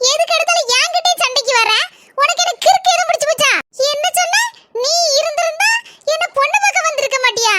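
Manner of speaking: high-pitched indignant tirade; angry quarrelling. Acted. Wife rowing with sister-in-law over her marriage, angry and high.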